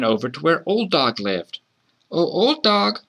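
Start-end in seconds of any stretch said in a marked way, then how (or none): 2.1-3.0 put-on high child's voice hailing a dog; light, high-pitched call. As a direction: call out high like a little kid. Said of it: "the child called out".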